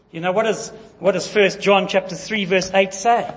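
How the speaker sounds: ordinary talk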